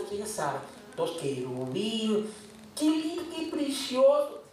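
ordinary delivery

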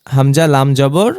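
ordinary delivery

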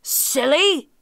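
high, loud scolding outburst; interrupting. A narrator's reading. Tiger corrects the muddled jackal, cutting in. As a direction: cut in crossly. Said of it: "interrupted the tiger".

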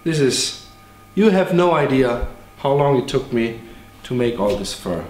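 ordinary delivery

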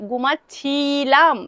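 ঘুমাচ্ছিলাম drawn out very slowly, every syllable clear; slowly spelled out. Shown as a demonstration. Teacher making students hear the past ending, very slow. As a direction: stretch ঘুমাচ্ছিলাম so the ছিলাম ending stands out. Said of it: "খুব বেশি minorly তোমাদেরকে শুনতে হবে".

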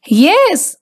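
ordinary delivery, nothing marked

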